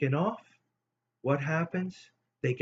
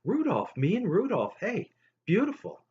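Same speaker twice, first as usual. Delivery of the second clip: put-on delighted kid; 8 semitones higher than usual. Kid's delighted reply.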